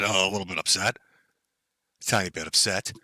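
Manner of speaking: mock understatement. Mocking the ranting streamer to chat, a soft sarcastic understatement. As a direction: undersell it with a sly quiet dig.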